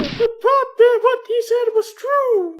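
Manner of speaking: pleading daughter. Acted. A daughter pleading with her father for her lover. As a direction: beg your father to believe him.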